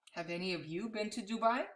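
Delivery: plain reading voice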